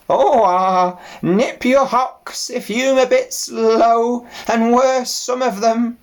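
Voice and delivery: put-on slow, high bleating old sheep; old ewe voice. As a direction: drawl it like a tired old ewe.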